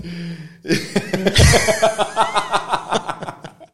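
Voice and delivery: long high-pitched laugh; real burst of laughter; laughing hard. Real, not acted. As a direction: crack up helplessly at the story. Both crack up at story.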